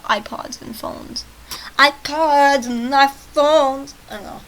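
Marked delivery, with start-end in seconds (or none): 1.8-3.9 loud, very drawn-out mocking call; mocking exclamation. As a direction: stretch each word out loudly. Mocks the dated phone talk.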